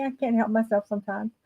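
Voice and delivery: normal talking voice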